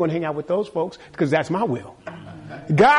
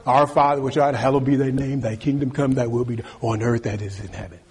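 recited prayer; 7 semitones lower than usual. Acted.

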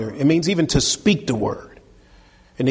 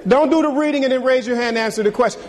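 rattled off fast and a bit higher, teasing; quick mock advice; 7 semitones higher, faster than usual. Shown as a demonstration. Preacher teasing the youth about skipping reading, fast mock advice. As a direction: toss off the sly tip quickly, tongue in cheek. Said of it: "laughs, then repeats the advice".

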